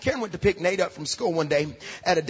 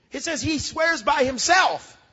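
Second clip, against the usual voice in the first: preaching emphasis; 6 semitones higher, slower than usual. Acted.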